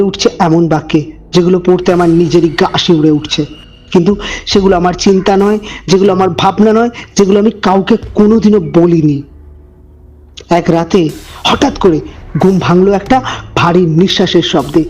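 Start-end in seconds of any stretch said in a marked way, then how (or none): none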